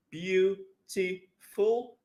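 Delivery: beautiful stretched out slowly; slow syllable demo. Shown as a demonstration. Teacher slowing down beautiful so students count syllables. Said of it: "three syllables".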